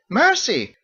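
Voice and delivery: high, loud surprised exclamation; startled cry. A narrator's reading. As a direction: jump at the flame. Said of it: "She cried".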